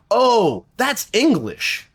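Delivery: high, slow realization; a dawning, high-pitched oh; mock surprise. Hosts retelling a Scottish accent mix-up, surprised.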